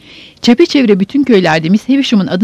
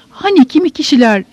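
higher-pitched, tactful tentative question; hint at it politely, pitched up; delicate hinting; 6 semitones higher than usual. A narrator's reading. Joe carefully hinting to his wife, delicate and tentative. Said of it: "Joe inceliğinden neredeyse kırılarak".

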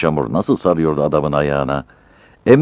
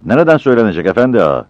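eager porter; 7 semitones higher, 6 dB louder, faster than usual. Acted. Porter asks the boss quickly.

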